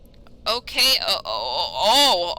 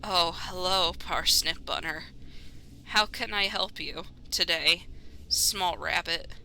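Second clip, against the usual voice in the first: put-on lower, softer, slow old voice; gentle elder voice; 3 semitones lower, 7 dB quieter, slower than usual. Old sheep greeting the rabbit, low and gentle. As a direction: speak like a kindly old sheep.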